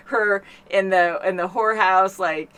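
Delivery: usual speaking voice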